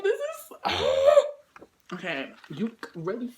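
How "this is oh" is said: overwhelmed squeal. Real, not acted.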